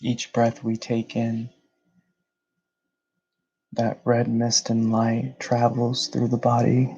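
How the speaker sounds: measured meditation guide, long pauses between phrases; guided meditation. Real, not acted.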